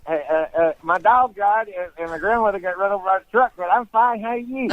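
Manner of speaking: high Appalachian voice, smiling through bad news; caricatured cheerful Tennessee mountain accent; Eastern Tennessee accent. Shown as a demonstration. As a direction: keep a fixed smile in hillbilly speech. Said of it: "look like they're smiling no matter what happens".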